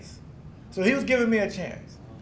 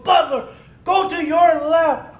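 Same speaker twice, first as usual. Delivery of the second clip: coach yelling; 7 semitones higher, 7 dB louder, slower than usual. Retold or imitated.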